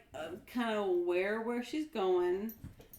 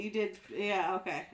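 ordinary talk, nothing marked

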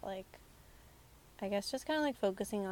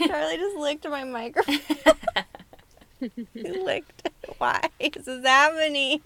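laughing; 7 semitones higher, 14 dB louder than usual. Real, not acted. Dog licks her microphone.

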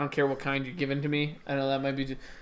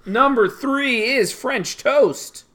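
high, loud and slow call; slow announcing; 8 semitones higher, 6 dB louder, much slower than usual. Acted. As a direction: draw out the announcement.

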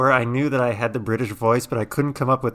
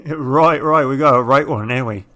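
mock British accent; 2 semitones higher, 5 dB louder than usual. Joins the British bit.

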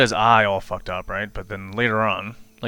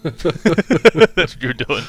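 laughing; 6 semitones higher, 7 dB louder, much slower than usual. Real, not acted. Teasing with laughter.

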